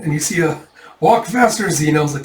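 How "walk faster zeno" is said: impatient call. Acted. Urging Zeno on.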